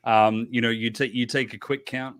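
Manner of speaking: usual speaking voice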